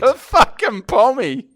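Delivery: high, slow, loud disbelief; incredulous reveal. Real, not acted. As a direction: land the punchline, stretched out and loud. Punchline reveal that he is English.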